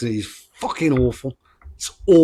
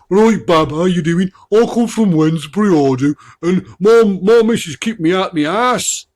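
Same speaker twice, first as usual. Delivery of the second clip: Johnny Cole; 7 semitones higher, 7 dB louder than usual. Retold or imitated. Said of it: "when he was listening to him".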